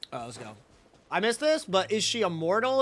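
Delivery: normal talking voice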